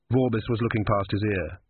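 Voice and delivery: ordinary delivery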